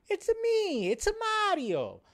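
high put-on Italian Mario voice; squeaky Italian plumber impression; Mario accent. Vlogger riffing on Leto as Mario in Italian accent. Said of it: "Why can't he be Mario?".

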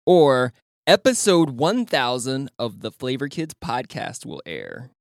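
slow, deliberate read. Real, not acted. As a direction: read each word out slowly. Reading option slowly.

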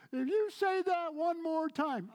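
very high, loud and slow mock exasperation; exasperated mock complaint. Preacher mimicking annoyed listeners, loud exasperated whine. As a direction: play the fed-up listener, stretched out. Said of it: "people have said".

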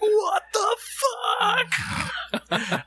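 very high, cracking outraged cry; shocked mom. Retold or imitated. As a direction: shriek it like a stunned mom. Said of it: "And my mom was like".

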